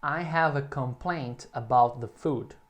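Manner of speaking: slow, carefully spaced example; slow model sentence. Shown as a demonstration. Teacher gives students a sentence to repeat, slowly. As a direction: say it slowly for repetition.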